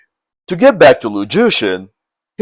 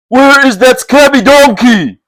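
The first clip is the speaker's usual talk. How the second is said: high, louder challenge; shouting; 9 semitones higher, 4 dB louder than usual. Acted. Brigand chief challenging Lu at the manor, shouting. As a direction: call out the enemy. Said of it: "shouted the brigand chief".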